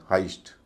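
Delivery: हाइस्ट with an "eye" vowel; correct pronunciation. Shown as a demonstration. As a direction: say हाइस्ट as "hyst". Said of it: "इसका उच्चारण". (हाइस्ट, बीच में "आइ" की आवाज़)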